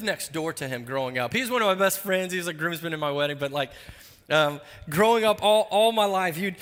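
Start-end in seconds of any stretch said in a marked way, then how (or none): none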